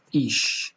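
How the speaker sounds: ایش with the final sound a light hiss; German ich-sound. Shown as a demonstration. Teacher models ایش for Persian learners. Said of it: "نحوهٔ تلفظش هم به صورت ایش هستش".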